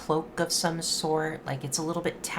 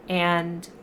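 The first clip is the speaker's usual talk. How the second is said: usual speaking voice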